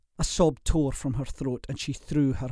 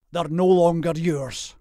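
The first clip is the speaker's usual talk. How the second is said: louder, higher angry retort; mild growl; 4 semitones higher, 6 dB louder than usual. A narrator's reading. Jack rebukes her father over belongings, growling. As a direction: growl it firmly, raised voice. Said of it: "he growled".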